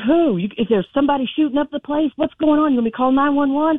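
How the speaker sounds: high-pitched, rapid-fire alarmed questions; retold panic. Daughter questions mom about an attack, retold panic. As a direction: act out panicking on the phone. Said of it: "I mean, I immediately panicked".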